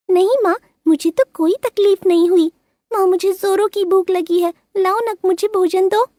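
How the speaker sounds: put-on very high childlike bird voice; little bird girl. A narrator's reading. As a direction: answer sweetly like an obedient little bird. Rinki lies to mother.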